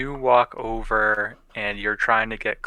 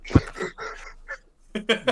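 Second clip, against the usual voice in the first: high, jolted awake yelp; startled Connor; 13 semitones higher, 3 dB quieter, faster than usual. Retold or imitated. Connor waking up to the fart, high startled yelp. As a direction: snap awake and squeak it out. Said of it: "He's like".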